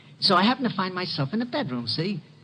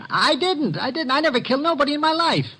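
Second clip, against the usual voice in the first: higher, fast panicked denial; frightened small-time crook protesting; desperate protest; 11 semitones higher than usual. Acted. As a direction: plead it quickly, voice climbing. Accused man protests innocence.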